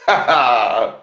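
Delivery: drawn-out open "ha-ha-ha"; mocking laugh. Real, not acted. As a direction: laugh long at the rival. Laughs at the opponent.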